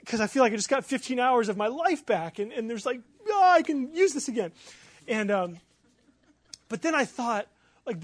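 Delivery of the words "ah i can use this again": high, loud burst of relief and joy; elated cry. Retold or imitated. Man getting fifteen hours back, elated. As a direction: exclaim it like a rescued treasure.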